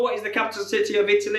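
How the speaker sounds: normal talking voice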